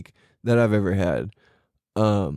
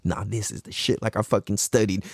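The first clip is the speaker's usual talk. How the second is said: low, cocky inner voice; privately boast, low and sure; smug inner monologue; secretly proud; 6 semitones lower, 3 dB quieter than usual. Acted. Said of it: "in the back of your head".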